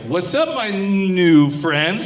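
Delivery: loud, stretched-out hesitation on "new"; stalling greeting. Acted. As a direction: hold the n of "new" as if nearing a forbidden word. Nervously approaching friends, stalls on word.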